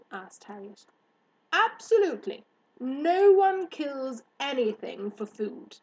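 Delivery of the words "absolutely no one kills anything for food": loud, high, stretched-out assertion; emphatic hare. Acted. Hare answering girl about Pars, proudly emphatic. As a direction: declare it with proud certainty.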